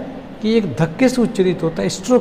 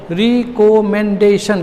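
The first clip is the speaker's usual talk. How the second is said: "Recommendation" slowly, syllable by syllable; split into syllables; much slower than usual. Shown as a demonstration. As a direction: mark each of the five beats in "recommendation". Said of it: "क्या टुकड़ा होगा बोलो".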